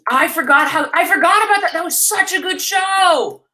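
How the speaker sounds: light up as it comes back to you; excited recall. Real, not acted. Excited sudden recollection.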